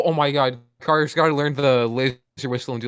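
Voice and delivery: usual speaking voice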